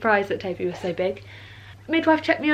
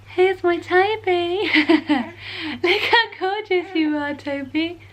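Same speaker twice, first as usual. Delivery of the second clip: high, slow and doting; 6 semitones higher, slower than usual. Real, not acted. Cooing at her baby on camera.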